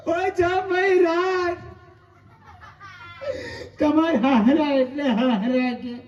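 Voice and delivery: slow, hammed-up crying lament; a comic wail of a widow's mourning; mock wailing. Mother-in-law wailing to her son-in-law, a slow mock cry.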